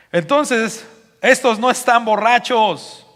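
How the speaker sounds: loud, high, slow declaration; authoritative proclamation. Acted. Peter defends the disciples before the crowd, authoritative proclamation. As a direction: ring it out over the crowd. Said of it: "a hablar con autoridad, con seguridad".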